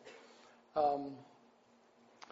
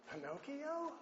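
put-on surprised old man; 11 semitones higher, 10 dB quieter than usual.

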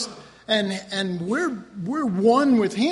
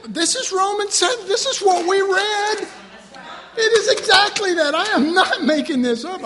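high, loud, laughing emphasis; excited insistence; 12 semitones higher, 6 dB louder than usual. Real, not acted. Preacher insisting to the congregation, high and loud with laughter. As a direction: insist it's really written there.

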